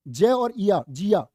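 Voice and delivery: जय और इया said very slowly, blended into जिया; mock mispronunciation. Shown as a demonstration. Teacher slowly mimics students saying जिया. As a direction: drag out जय and इया as the students' error. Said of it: "क्यों बोल रहे थे जी हमको इसको जिया".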